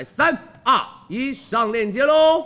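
high, loud and slow countdown; excited countdown. Real, not acted. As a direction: build the hype for the link drop. Countdown to product link.

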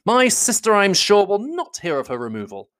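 high, urgent objection; emphatic protest. A narrator's reading. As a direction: cry out in protest. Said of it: "cried Bingley".

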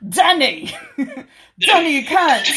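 high, loud hammed-up northern English shout; laughing mock northern yell; Jon Snow accent. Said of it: "something that Jon Snow would say".

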